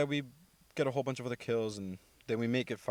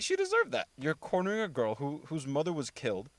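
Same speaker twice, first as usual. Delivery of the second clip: high, sharp indignant outburst that gets louder at the end; 5 semitones higher, 3 dB louder than usual. Retold or imitated. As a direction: take her side, outraged, and finish with a loud back off. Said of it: "And I was like".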